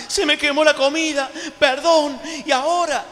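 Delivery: high, fretful panic over burnt food; mock panic over "Se me quemó la comida"; mock drama. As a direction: ham up a fussy overreaction. Mocking someone making a drama.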